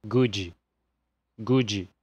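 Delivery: good ending in a dji sound, like gudji; Brazilian English good. Shown as a demonstration. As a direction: turn the final d of good into dji. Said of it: "mas acaba virando". (good abrasileirado, com dji no final)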